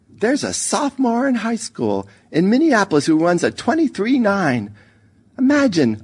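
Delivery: high, animated boastful storyteller; mother telling her story. A narrator's reading. Mother ending her favorite Olympic story, animated high voice. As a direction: pitch it well up and marvel at the runner. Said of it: "Her story would always end like this".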